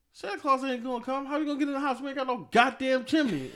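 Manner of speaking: put-on cursing child outburst. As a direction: blurt it out like a kid cursing at grandma. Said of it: "and this time I did curse".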